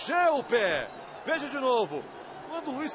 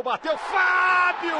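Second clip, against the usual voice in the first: slow, higher, louder call of the name; excited shout; 7 semitones higher, 5 dB louder, much slower than usual. Real, not acted. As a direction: stretch the keeper's name out big. Commentator calls a big save.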